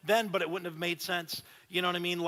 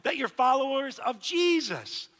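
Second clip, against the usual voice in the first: rising to a higher, louder appeal; voicing Paul; 6 semitones higher, 6 dB louder than usual. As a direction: lift it up as Paul's promise. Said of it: "He's like".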